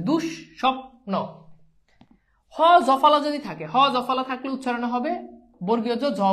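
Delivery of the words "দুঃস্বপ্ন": দুঃস্বপ্ন said very slowly as dusshopno with a long double s; teacher spelling out দুঃস্বপ্ন with a long held ss; doubled s. Shown as a demonstration. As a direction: stretch out দুঃস্বপ্ন, leaning on the doubled s. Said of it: "এই যে স দুইবার হয়েছে".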